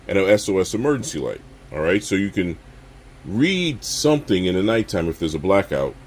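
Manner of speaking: usual speaking voice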